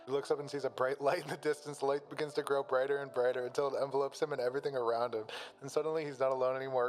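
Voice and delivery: ordinary delivery, nothing marked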